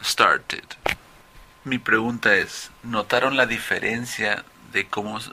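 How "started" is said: "Started" said alone, ending in an extra syllable ed; model word started. Shown as a demonstration. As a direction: repeat "Started" with the ed clearly voiced. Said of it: "Y la tercera es started".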